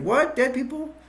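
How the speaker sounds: high, incredulous echo; mock disbelief. Man at the door hears of walking dead, acted disbelief. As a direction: voice jumps up in acted astonishment. Retold startled reaction to warning.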